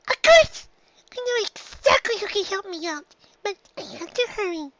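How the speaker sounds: high filly voice bursting with an idea; a filly who just got it; young filly Dinky, sudden idea. A narrator's reading. Filly hitting on an idea, high and excited.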